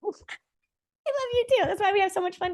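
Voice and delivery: high, slow, drawn-out and teasing; playful sing-song. Real, not acted. Answering her partner's I love you: slow, high, playful. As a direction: stretch it out high like a playful coo.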